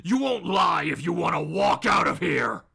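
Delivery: forceful, shouted interrogation; Batman. Acted. The vigilante demanding answers from Bob, shouting threats. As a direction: press hard with loud menace. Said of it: "And Batman goes".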